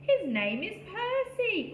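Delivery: high excited cry. A narrator's reading. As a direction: blurt it out gleefully. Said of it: "cried the rabbit".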